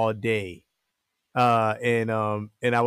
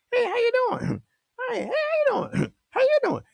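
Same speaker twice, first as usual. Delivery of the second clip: put-on very high, cheery old-man falsetto, laughing between greetings; high-pitched neighborhood old man calling hello with chuckles; friendly old man; faster than usual. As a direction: squeak out greetings like the old man waving at cars. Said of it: "He used to wave at every car".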